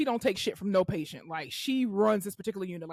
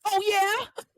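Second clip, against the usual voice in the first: high, drawn "oh yeah?"; challenging comeback; 10 semitones higher than usual. Retold or imitated. Nurse retelling her comeback to a rude patient, mock challenge. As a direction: answer back in mock disbelief.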